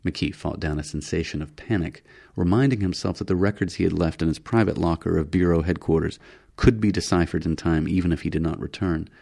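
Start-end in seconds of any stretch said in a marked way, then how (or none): none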